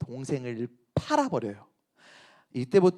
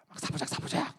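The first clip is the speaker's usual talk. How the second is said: whispered sneaking; 12 dB quieter, faster than usual. Retold or imitated. Secret plotting.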